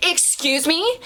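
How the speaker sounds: high mock call with rising end; imitated yell. Customer calling across the restaurant, mock yell. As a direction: mimic her calling out, high and questioning. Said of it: "I hear it again louder".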